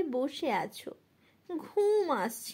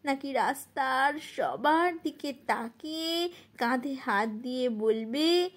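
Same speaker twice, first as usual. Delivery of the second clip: ordinary delivery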